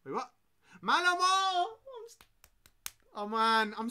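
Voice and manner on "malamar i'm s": a high, loud outburst of the name; exasperated shout. Real, not acted. Streamer suddenly remembering the name, shouting it out annoyed. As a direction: blurt it out in frustration.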